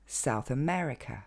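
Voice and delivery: South America à l'anglaise, ME plus marqué que South; accent secondaire sur South. Shown as a demonstration. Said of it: "va recevoir un accent tonique secondaire". (South America, double accent)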